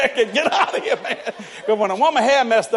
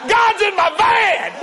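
slow, drawn-out, high and loud cry of joy; ecstatic slow shout; 12 semitones higher, 6 dB louder, much slower than usual. Retold or imitated. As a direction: shout it like the Spirit just hit you.

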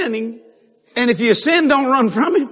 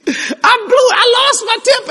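very high-pitched acted wail; distressed confession; 4 dB louder, slower than usual. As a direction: wail the admission in mock anguish.

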